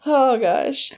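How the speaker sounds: ordinary delivery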